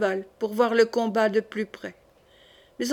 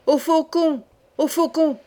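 raised, higher rallying call; cried out; 5 semitones higher, 3 dB louder than usual. A narrator's reading. Charles sending the hunt to the fallen birds, crying out. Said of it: "cria Charles".

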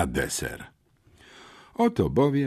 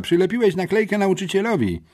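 high, quick, gleeful taunt; giggly mockery; 9 semitones higher, faster than usual. A narrator's reading. Classmate mocking boy over sticker on teacher, gleeful. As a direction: rattle it off teasingly, pitched high. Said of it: "zachichotała Nowicka".

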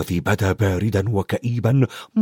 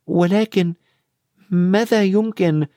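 higher-pitched, hesitant question; young character voice, drawn-out ending; questioning child; 7 semitones higher, slower than usual. A narrator's reading. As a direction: ask it haltingly in a raised child's voice. Friend asks worriedly what to do.

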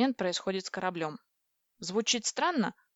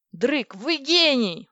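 loud, higher, drawn-out cry of delight; joyful exclamation; 5 semitones higher, 7 dB louder, much slower than usual. A narrator's reading. Heroine thanking Dryk for his ship, exclaiming. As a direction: exclaim it big, stretching the words. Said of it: "воскликнула я".

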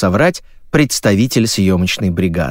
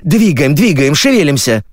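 raised, higher, pushy voice; urgent hustling; 7 semitones higher, 4 dB louder than usual. A narrator's reading. Crew member hurries the lady to the set, urgently. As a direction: chivvy them along.

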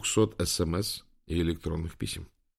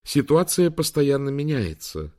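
higher-pitched, unruffled assistant; composed AI assistant; 7 semitones higher, 4 dB louder than usual. A narrator's reading. AI assistant briefing the fugitives by phone, composed. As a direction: report it evenly in a lifted voice.

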